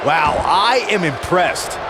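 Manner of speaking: ordinary talk, nothing marked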